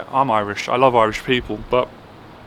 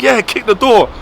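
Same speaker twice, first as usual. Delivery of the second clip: higher, curt, imitating the boss's impatient order; dismissive order; 7 semitones higher than usual. Boss curtly orders the apprentice to kick. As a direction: snap it off like an impatient foreman. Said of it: "And that's how he was speaking to me".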